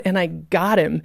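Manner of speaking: ordinary talk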